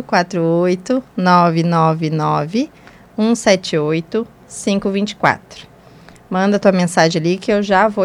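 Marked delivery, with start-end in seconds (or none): 0.0-5.7 slow, spaced-out phone number repeat; slow and deliberate. Real, not acted. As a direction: repeat each digit slowly and clearly.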